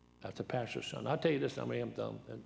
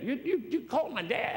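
high, stammering outburst; incredulous mock shout; shocked disbelief; 11 semitones higher than usual. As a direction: imitate an alarmed son, pitched way up. Mimics son's shock at the call.

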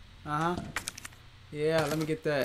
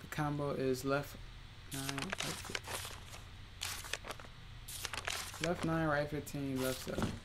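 muttering; 2 semitones lower, 12 dB quieter than usual. Real, not acted. Reading safe combo quietly.

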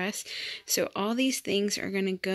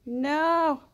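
higher, sing-song cooing at a newborn; baby talk; 8 semitones higher, 4 dB louder than usual. Acted. Mom cooing at her newborn daughter, sing-song baby talk. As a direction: coo sweetly over the baby.

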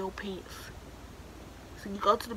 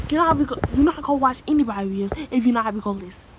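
raised, exasperated voice at someone ignoring you; fed-up loud telling off; loud scolding; 3 semitones higher, 9 dB louder, faster than usual. Real, not acted. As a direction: snap at them to listen, loud. Scolding someone off camera, louder.

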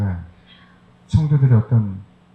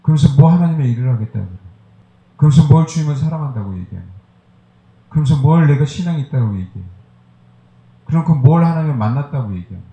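rapid, raised preaching rebuke; heated preaching; 3 semitones higher, faster than usual. Acted. Pastor scolding the congregation, rising heated preaching. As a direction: hammer each line of the rebuke home.

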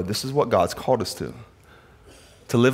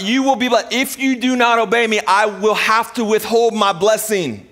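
high, over-formal dad proclamation; mock-stern parent; 9 semitones higher than usual. Dad warning disobedient kids at home, hammed-up stern. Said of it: "This is how I talk in my house".

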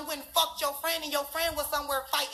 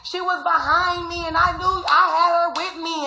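sing-song mock of a lame excuse; 3 semitones higher, 11 dB louder than usual. Imitating the best friend's excuse in a mocking sing-song.

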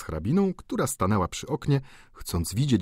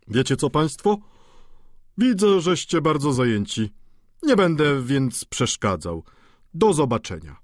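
arch, louder parting quip; epigrammatic; 6 semitones higher, 5 dB louder than usual. A narrator's reading. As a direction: toss off a witty goodbye. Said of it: "rzekł bardzo epigramatycznie".

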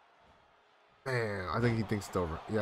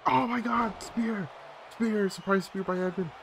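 high-pitched, astonished cry; excited shock; 10 semitones higher than usual. Real, not acted. Viewer reacting to a surprise spear, excited shock. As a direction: call the move out high and thrilled.

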